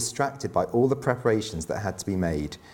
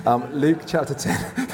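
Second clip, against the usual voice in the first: slower, laughing through the words; half-laughing repeat of the reference; 4 semitones higher, 3 dB louder, slower than usual. Shown as a demonstration. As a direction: say it again while chuckling at the joke. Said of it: "so that was a joke".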